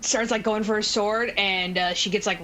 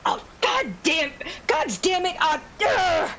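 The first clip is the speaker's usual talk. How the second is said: high-pitched exasperated swearing; worked-up, higher-voiced frustration; frustrated cursing; 8 semitones higher than usual. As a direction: curse while stuck and struggling. Stuck in mud, acted frustration.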